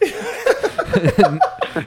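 cracks up hard. Real, not acted. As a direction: burst of high-pitched laughter. Laughing at Brett's text.

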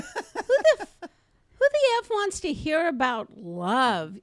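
high, outraged retort; scornful retort. Retold or imitated. Comedian scoffing at a student asking about love. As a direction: scoff at the idea. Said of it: "And I go".

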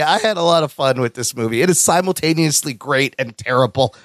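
ordinary talk

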